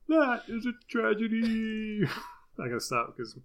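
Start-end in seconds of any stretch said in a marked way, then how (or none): 0.1-2.0 high, sustained, near-sung mock lament; John Piper. Repeating the preacher bit for laughs, in a high singing wail. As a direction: stretch it out high and slow, hammed-up. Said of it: "Zoom's gonna think I'm singing".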